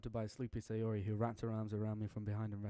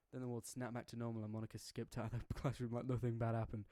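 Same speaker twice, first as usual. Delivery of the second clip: usual speaking voice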